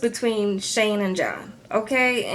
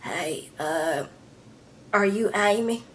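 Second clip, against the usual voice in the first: lightly voiced husky smoker, lower on the first words; a hint of a raspy smoker; imitating a smoker's low rasp; deep smoker voice; 3 dB quieter, slower than usual. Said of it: "Angela with that deep smoker voice".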